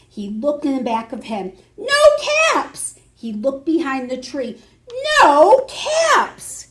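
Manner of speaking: ordinary delivery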